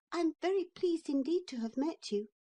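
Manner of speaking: put-on high, prim boyish voice; polite little boy. A narrator's reading. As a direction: excuse yourself politely. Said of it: "Neville was always a polite little boy".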